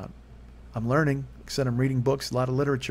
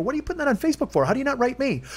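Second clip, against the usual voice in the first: indignant retort; 12 semitones higher, 3 dB louder, faster than usual. Retold or imitated. Angrily confronting her on Facebook.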